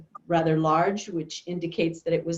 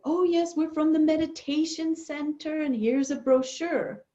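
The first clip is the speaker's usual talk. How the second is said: much higher, sugary friendly voice; imitating the colleague; 11 semitones higher than usual. Colleague sweetly charming the upset postman, high imitated voice. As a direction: play the sweet, disarming colleague charming a grump.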